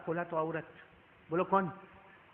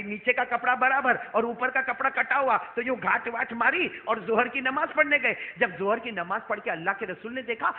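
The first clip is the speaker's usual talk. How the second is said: ordinary talk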